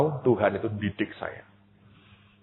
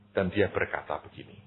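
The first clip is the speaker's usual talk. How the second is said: ordinary delivery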